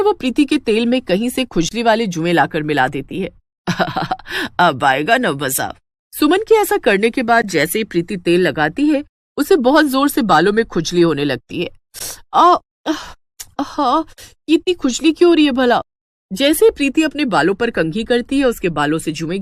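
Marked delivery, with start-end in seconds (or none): 3.7-4.5 sly cackle. Acted. Scheming mother-in-law. 4.6-5.7 lower, slow sly gloat. Acted. Mother-in-law gloats. 12.3-15.8 squirm and complain; itchy distress. Acted. Itching scalp.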